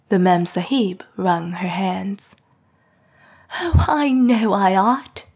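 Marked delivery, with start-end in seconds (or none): 3.6-5.2 higher, louder anguished exclamation; distressed cry. A narrator's reading. Mrs Lennox to the officer, crying out in distress. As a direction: cry out in self-reproach. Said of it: "she cried".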